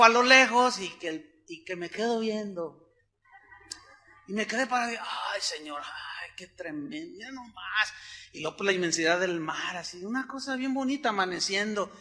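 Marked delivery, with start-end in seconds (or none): 5.0-7.9 awed murmur. Retold or imitated. Awed at the sunrise.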